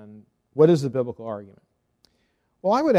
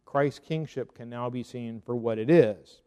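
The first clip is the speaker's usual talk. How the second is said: ordinary talk, nothing marked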